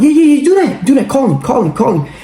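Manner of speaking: high, eager urging; high, keen egging-on. Retold or imitated. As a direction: push her to make the call. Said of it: "I'm telling her".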